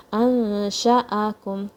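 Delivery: "أنشأكم" with the n hidden in a held nasal hum; ikhfa with ghunna. Shown as a demonstration. Teacher showing ikhfa, noon hidden in the nose in "أنشأكم". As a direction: keep the tongue off, hum the n of "أنشأكم". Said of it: "hide in your nose".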